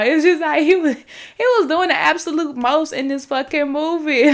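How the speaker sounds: high, loud complaint; exasperated rant. Real, not acted. Reviewer complaining he did the most in the movie, exasperated rant. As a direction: let the exasperation push pitch and volume up. Heated rant about the character.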